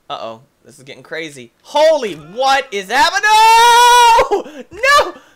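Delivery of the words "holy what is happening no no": high, loud yell climbing to a wail; panicked shouting. Real, not acted. Player at the game as it gets crazy, panicked shouting. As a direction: shout in alarm as control slips away.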